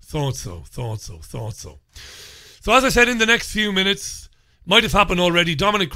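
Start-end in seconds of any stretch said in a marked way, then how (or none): 0.0-1.8 low, ever softer repeats; fading mutter. Pretend. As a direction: mutter it away to yourself, fading out. Muttered response to mock interview.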